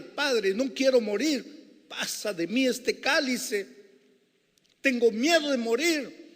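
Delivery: high, loud tearful plea; Jesus in Gethsemane begging his father, high and loud; mock weeping plea. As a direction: beg the father in tears.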